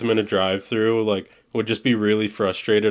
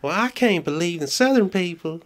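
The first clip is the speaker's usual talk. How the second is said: much higher Southern lady voice; 9 semitones higher than usual. Retold or imitated. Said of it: "an old Southern woman".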